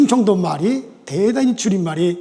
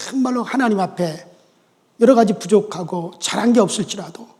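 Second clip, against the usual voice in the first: ordinary talk, nothing marked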